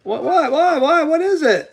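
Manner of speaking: high, rapid-fire confused questions; baffled. Real, not acted. Host demanding what barbie means, baffled.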